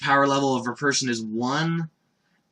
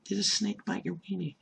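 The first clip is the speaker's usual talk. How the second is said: quiet repeat; 4 semitones higher, 12 dB quieter than usual. Retold or imitated. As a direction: murmur it back to yourself in disbelief.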